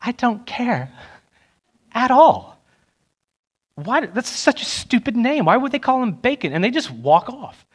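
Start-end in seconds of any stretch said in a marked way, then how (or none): 0.0-6.5 higher, disdainful imitation of college girls; preacher mimicking girls unimpressed by the name Bacon; mocking dismissive girls. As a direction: mimic bored girls brushing him off. The girls would be like.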